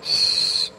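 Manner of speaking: sharp voiceless shush. Acted. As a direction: hush her quickly before the house wakes. Hushing the loud sister-in-law. (घाईने केलेला श्श्श)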